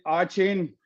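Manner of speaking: Achane as "ah-CHAYN"; pronunciation query. Shown as a demonstration. Host checking a player's name, Achane tried as "ah-chain". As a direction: say Achane with an "ah" start and "chain" ending. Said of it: "how do we pronounce it?".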